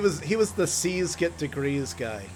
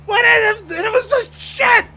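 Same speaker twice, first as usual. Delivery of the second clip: sudden full-blast ranting teacher, much higher; hammed-up screaming impression, very loud; 11 dB louder than usual. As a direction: explode into an over-the-top history lecture. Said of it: "he would yell like that too".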